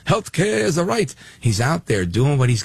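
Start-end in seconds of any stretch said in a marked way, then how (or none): 0.1-1.1 high, strained mock Bernie voice; mocking Bernie's healthcare slogan in a pinched high voice; Bernie Sanders impression. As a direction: mimic Bernie preaching his slogan. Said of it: "Bernard Sanders".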